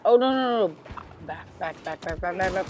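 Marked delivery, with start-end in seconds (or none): 0.0-0.8 panicked shout. Real, not acted.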